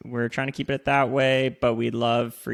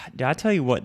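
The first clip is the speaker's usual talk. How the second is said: usual speaking voice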